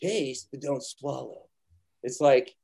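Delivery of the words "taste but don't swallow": Pacino quote. Retold or imitated. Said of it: "what does Pacino say".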